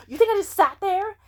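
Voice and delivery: high, loud, fast offended rant; indignant outrage. Retold or imitated. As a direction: fire back, insulted and defensive. Retelling friend's defensive outburst.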